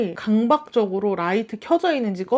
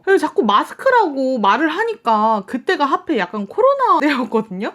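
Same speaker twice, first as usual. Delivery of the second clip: ordinary talk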